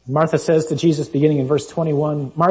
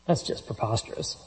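whispered aside; 4 semitones lower, 9 dB quieter than usual. Real, not acted. Aside after Jesus quote.

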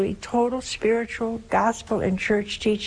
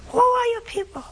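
very high-pitched preacher scolding, wailing on a held pitch; curé of Ars impression; 8 semitones higher than usual. Nun imitating the curé scolding his people, very high-pitched. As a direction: mimic a high-pitched priest lamenting his flock's damnation. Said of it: "the curé had a very high-pitched voice".

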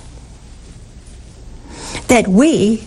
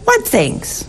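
innocent high question; 9 semitones higher than usual. Acted. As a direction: ask it with feigned innocence.

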